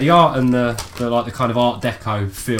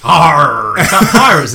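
pirate voice; 3 semitones higher, 10 dB louder, slower than usual. Pretend. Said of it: "say it in a pirate's voice".

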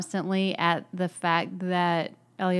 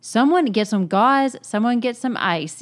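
loud, higher-pitched take-charge order; acted urgent command; 4 semitones higher, 8 dB louder than usual. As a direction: imitate someone taking control in an emergency. Acts Lise taking control.

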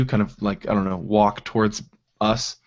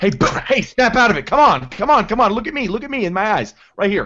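high, raised, insistent commands; urgent shouting; 10 semitones higher, 5 dB louder than usual. Acted. Character slaps panicking boy, urgent shouting. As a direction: shout him back to his senses. Slapping screaming boy to his senses.

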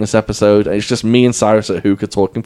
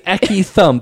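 put-on Northern English accent; 8 semitones higher than usual. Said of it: "She says".

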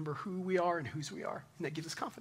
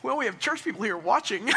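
embarrassed thought; 6 semitones higher, 7 dB louder than usual. Retold or imitated. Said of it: "And I thought".

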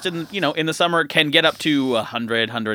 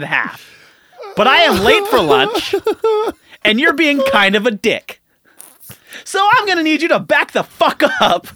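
higher, louder mock tirade at the foreman; furious rant; 5 semitones higher, 4 dB louder than usual. Telling off the rude foreman at the parking meter, furious yelling. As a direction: let the pent-up anger rip, half joking.

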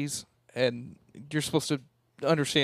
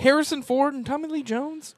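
incredulous; 12 semitones higher, 3 dB louder than usual. Real, not acted. Incredulous about movie opinion.

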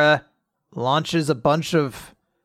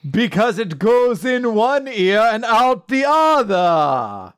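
punchline delivery; 5 semitones higher, slower than usual. Acted. Punchline of a corny joke.

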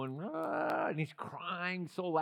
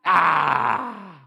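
preacher's shout; 11 dB louder than usual. Real, not acted.

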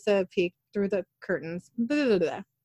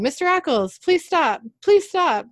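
high, louder mock-urgent begging; urgent plea; 9 semitones higher, 6 dB louder than usual. Man outside the car window begging Mister Ackles, urgent plea. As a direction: plead with the driver, pitched up high.

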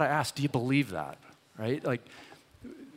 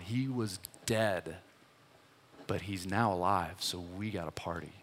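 softer, lower, hushed reassurance; tender father; 4 semitones lower, 6 dB quieter than usual. Retold or imitated. Father softly reassuring his older son outside the party. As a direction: gently draw the angry son back in.

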